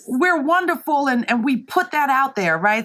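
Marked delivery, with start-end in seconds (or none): 0.1-1.1 smug mock pride. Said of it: "it was like this".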